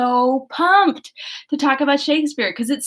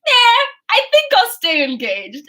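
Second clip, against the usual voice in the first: nonchalant; 8 semitones higher, 5 dB louder than usual. Acted. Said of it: "Edward, for God knows what reason, is like".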